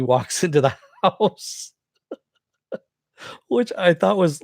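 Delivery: real laugh breaking into the words; laughing while talking. Real, not acted. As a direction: talk through a genuine chuckle. Amused by the scene being retold.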